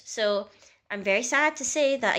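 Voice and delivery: ordinary talk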